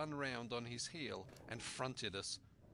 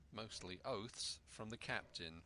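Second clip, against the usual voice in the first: ordinary delivery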